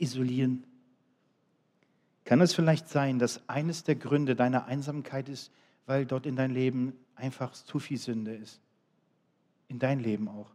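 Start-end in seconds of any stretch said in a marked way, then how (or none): none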